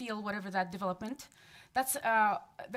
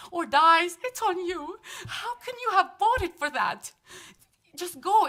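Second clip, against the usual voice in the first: raised, higher and louder pleading accusation; upset demand; 6 semitones higher, 10 dB louder than usual. Real, not acted. Mother accusing council over kids' safety, upset and loud. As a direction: confront them, voice climbing with distress.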